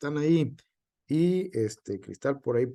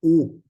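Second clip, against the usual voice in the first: u said much louder, on its own; strong stressed vowel; 3 semitones higher, 8 dB louder than usual. Shown as a demonstration. Teacher names the strong vowel for students, u stressed loudly. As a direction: punch out u as the strong sound. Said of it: "fuerte es la".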